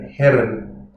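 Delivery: Herren slow, R rolled with the tongue forward; Herren, tongue-tip R trilled. Shown as a demonstration. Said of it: "with a rolled R with the tongue forward".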